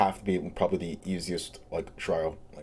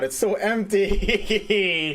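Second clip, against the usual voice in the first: dramatic exclaim; 10 semitones higher, 4 dB louder, much slower than usual. Pretend. Dramatic exclamation in empty room.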